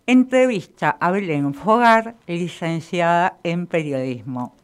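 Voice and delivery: talking while biting a pen; slow, loud words around a pen; pen in teeth. Shown as a demonstration. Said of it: "escucharon cual si fuese una persona con alguna dificultad".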